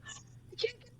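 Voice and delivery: whispered, disappointed; sad whisper. Retold or imitated. Losing her saved articles, a sad whisper. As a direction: breathe it out dejected.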